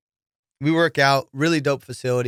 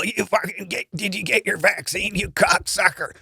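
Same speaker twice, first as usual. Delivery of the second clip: a higher put-on voice of an old politician, crude and teasing; RFK Jr. impression; 7 semitones higher than usual. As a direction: tease the old coach in a mock politician's voice. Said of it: "I start doing an impression of him".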